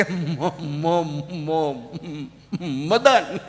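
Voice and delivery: stammering on the first syllable; stutter. Retold or imitated. Mock stutter as friend Magan answers his name. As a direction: stutter it out, stuck on mo.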